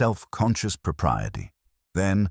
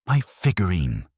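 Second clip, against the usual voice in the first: a sudden cry of dismay over the lost piece; exclaimed; 2 semitones higher than usual. A narrator's reading. Lady Penelope discovers her figurine missing, mild dismayed exclamation. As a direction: blurt it out, mildly shocked. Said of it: "Lady Penelope exclaimed".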